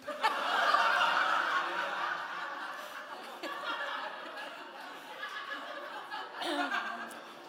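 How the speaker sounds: long high breathless laugh; big real laugh. Real, not acted. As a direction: laugh hard at your own punchline. Crowd-pleasing punchline laugh.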